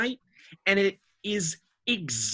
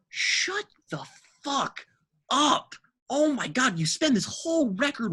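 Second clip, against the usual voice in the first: exasperated wail; 9 semitones higher than usual. Real, not acted. Hearing the idols lyric, groaning high in exasperation. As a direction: cry out like it physically hurts.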